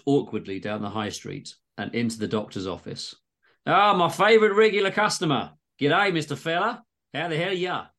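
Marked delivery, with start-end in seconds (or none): 3.7-7.9 hammed-up Aussie doctor, higher; cheery Aussie doc greeting his regular; exaggerated Aussie accent. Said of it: "all dialogue is in exaggerated Aussie accents".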